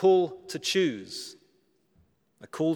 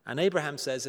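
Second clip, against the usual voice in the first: ordinary talk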